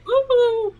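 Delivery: high, loud whoop; excited woohoo. Real, not acted. Cheering for a rainbow bandana, excited high whoop. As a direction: whoop with delight.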